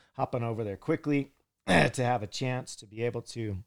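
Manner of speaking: husky, throat-cleared voice; frog in throat. Real, not acted. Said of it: "I got a frog in my throat this morning".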